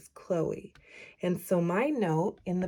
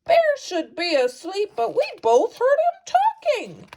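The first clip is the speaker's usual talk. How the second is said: high, bright-spirited hare greeting; cheerful hare; 11 semitones higher, 5 dB louder than usual. Acted. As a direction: call out like a bouncy hare. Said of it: "says Hare".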